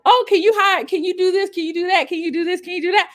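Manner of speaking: high, pleading voice of people asking favors; high mock-pleading clients; mock eager clients. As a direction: imitate pushy requests, pitched well up. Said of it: "people were just like".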